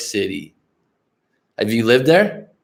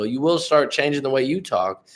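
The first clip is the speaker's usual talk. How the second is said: ordinary talk, nothing marked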